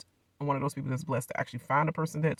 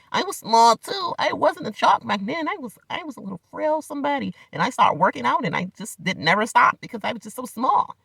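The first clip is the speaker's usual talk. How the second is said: mocking Gino's sob story; 8 semitones higher than usual. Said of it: "show your little fake bullying".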